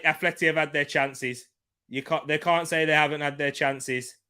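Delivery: ordinary talk, nothing marked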